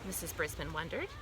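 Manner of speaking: plain reading voice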